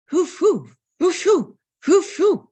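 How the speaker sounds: high, loud barking yelps; imitated dog barking. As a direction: bark like a small dog. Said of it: "the dog started barking".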